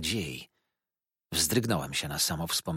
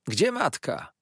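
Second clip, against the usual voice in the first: higher sharp call; 8 semitones higher, 4 dB louder than usual. A narrator's reading. Uriah calls out for his mother. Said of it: "zawołał".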